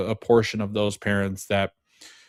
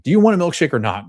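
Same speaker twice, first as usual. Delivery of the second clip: chirpy tension breaker; 7 semitones higher, 7 dB louder, faster than usual. Retold or imitated. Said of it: "break the tension by saying".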